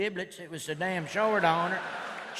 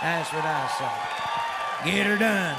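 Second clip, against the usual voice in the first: louder, slow then punched out; catchphrase shout; 2 semitones lower, 7 dB louder, slower than usual. Real, not acted. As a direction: bellow the catchphrase.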